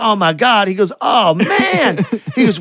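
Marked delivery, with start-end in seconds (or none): 0.0-0.7 mock dismay. Imitates dismayed adult reacting. 1.0-2.0 high, loud groan of exasperation, imitated; mock dismay. Imitating the adult who must drive the kid back, exasperated outcry.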